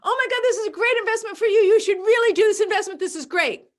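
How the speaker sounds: high, loud, fast gushing; gushing pitch. Pretend. A pitcher hyping a great investment, fast and gushing. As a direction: rush the hype out breathlessly.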